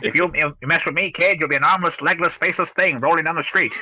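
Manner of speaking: cartoonish forties wise-guy voice; nineteen-forties tough guy. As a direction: menace the kid like an old movie mobster. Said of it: "Suddenly that voice turned into Inspector Gadget".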